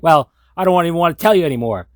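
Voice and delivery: loud, fast, high petulant huff; a sulking child slamming the door; pouty mock tantrum, rushed; sulky refusal. [name] sulks and refuses.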